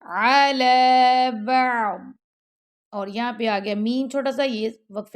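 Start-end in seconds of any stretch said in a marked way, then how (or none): 0.0-2.0 slow علی بعض with a full daad; heavy daad. Shown as a demonstration. Teacher repeating the daad again. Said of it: "ضاد کی آواز منہ کے اندر ہی رہ جائے گی".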